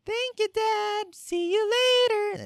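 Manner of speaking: put-on high, slow little-boy voice; way-up falsetto, drawn out and sweet; sweet kid falsetto. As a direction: sing it out like a sugary kid. Neighbor's sons voiced.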